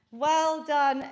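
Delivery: ordinary talk, nothing marked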